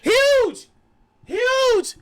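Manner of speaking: high, loud shout of sudden recall; excited outburst. Real, not acted. Podcaster finally recalls the character's name, shouting. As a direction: blurt it out as the name finally hits you.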